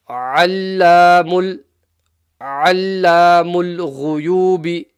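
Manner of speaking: ALLAMUL started with a throaty ayn, slow; mid-throat ayn. Shown as a demonstration. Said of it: "start with the ayn, middle of the throat".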